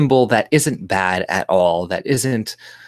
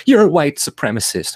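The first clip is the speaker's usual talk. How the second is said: higher-pitched imitation of an angry accuser; mock angry; 7 semitones higher, 4 dB louder than usual. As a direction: mimic someone worked up and accusing. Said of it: "for them to get angry".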